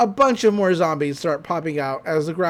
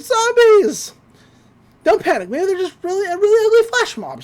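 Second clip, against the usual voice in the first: excited; 12 semitones higher, 4 dB louder than usual. Acted. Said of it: "says Mabel".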